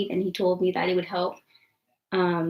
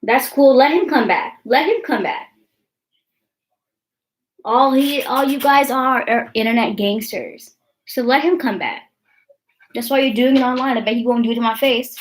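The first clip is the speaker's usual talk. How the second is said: raised voice, higher and louder; defiant challenge; 4 semitones higher, 5 dB louder than usual. Real, not acted. Streamer daring online trolls to come back, defiant and loud. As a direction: call out the troll, riled up.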